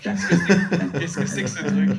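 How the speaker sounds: talking through real laughter; laughing. Real, not acted. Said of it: "c'est drôle". (en éclatant de rire)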